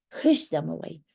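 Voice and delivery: normal talking voice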